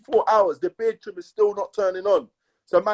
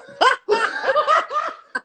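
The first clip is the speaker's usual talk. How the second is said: cracking up hard. Real, not acted. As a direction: burst of high laughter. Loud laugh, high.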